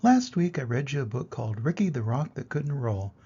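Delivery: ordinary delivery, nothing marked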